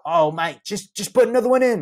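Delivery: high, pushy mate voice; insistent friend. Retold or imitated. Friend Brian insisting he try another seed, high pushy mate voice. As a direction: push him to try again.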